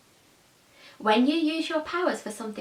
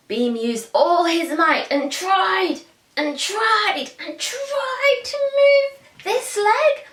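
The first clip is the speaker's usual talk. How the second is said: slow, high, strained narration as if heaving; straining; 8 semitones higher, 3 dB louder, slower than usual. A narrator's reading. Narrator acting out Beam's pushing, strained and slow. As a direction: squeeze the words out with effort.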